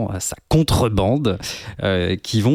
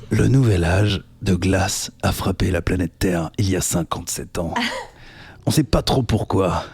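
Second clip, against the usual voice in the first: movie trailer voice. Pretend. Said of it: "comme un soldat qui a fait genre cinq guerres".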